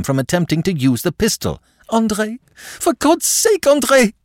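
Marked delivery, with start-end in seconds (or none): 1.9-4.1 high-pitched, desperate begging; panting plea. A narrator's reading. Madame over her shoulder to André, desperately pleading. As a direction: beg frantically, drawing out the words. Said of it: "she panted hoarsely".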